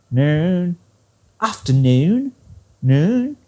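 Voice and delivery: "Noon" and "Afternoon" sliding up at the end; lifted "Noon". Shown as a demonstration. Teacher isolates the question ending, "Noon" rising. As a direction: stretch "Noon" and let it climb like a question. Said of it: "noon lifts up that word".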